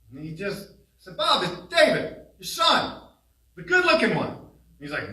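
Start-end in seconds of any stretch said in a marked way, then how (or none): 1.2-4.3 higher, loud, teasing call; loud and clear. Real, not acted. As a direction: speak up so he can hear you. Speaking loudly to failing father.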